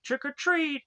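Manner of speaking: high-pitched trick-or-treat call; high mock kid chorus; kids' trick-or-treat. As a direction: lightly imitate kids popping up to trick-or-treat. Kids pop up shouting trick-or-treat.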